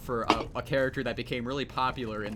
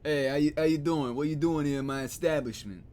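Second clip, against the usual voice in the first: mock tough New York mobster accent, pitched up; 4 semitones higher, 5 dB louder than usual. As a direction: ham up a movie mobster accent. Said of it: "like, a mobster accent".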